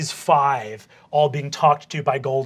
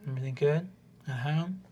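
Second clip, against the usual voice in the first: soft, probing counsellor voice; gentle gnome counsellor; 9 dB quieter than usual. Acted. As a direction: check in on them gently and quietly. Game master plays gnome counsellor.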